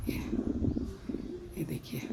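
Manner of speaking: hushed so the birds stay calm; whispered near nesting birds. Real, not acted. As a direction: breathe it out softly.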